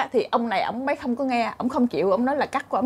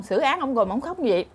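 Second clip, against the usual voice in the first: ordinary talk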